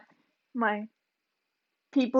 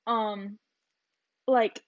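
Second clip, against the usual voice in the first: ordinary talk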